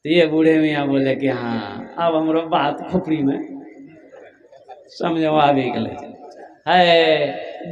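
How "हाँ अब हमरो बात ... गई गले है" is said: put-on lower, amused old voice; chuckling old man. Old husband admitting to his wife, low chuckle. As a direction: concede it with a rueful laugh.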